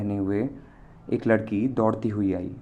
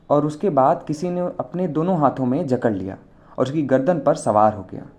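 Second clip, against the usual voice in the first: plain reading voice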